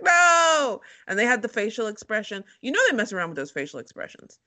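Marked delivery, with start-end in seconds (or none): 0.0-0.8 mock outraged no. Reacting to pescatarian.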